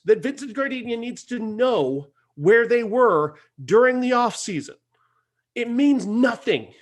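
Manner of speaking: high-pitched, incredulous complaint; exasperated rant. Real, not acted. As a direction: rant at a pointless plot, hitting key words. Exasperated rant about pointless plot.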